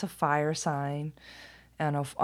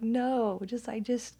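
higher-pitched grumpy protest; exasperated pleading; 7 semitones higher, slower than usual. Retold or imitated. Retold pleading for morning quiet.

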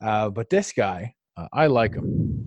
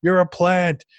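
mock accuser; 5 semitones higher than usual. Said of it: "he's like".

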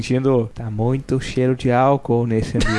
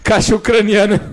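laughing; 7 semitones higher than usual. Real, not acted. Cracking up at the slip.

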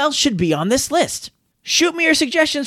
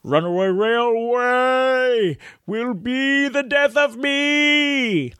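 mock despair; 2 semitones higher, much slower than usual. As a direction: groan it out like a cartoon sufferer. Exasperated joke over flubbed name.

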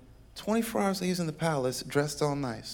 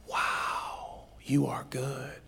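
breathless, hushed wonder; awed whisper; 2 semitones lower, 6 dB quieter, much slower than usual. Acted. Joseph overcome, seeing his brothers bow, a whisper. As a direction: whisper it in awe. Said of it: "and he says".

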